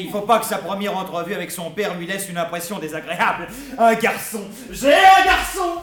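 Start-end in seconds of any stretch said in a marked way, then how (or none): 3.8-5.8 loud, high, slow triumphant cry; exultant shout. Acted. New father alone, shouting for joy over his son. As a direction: crow it out with pride.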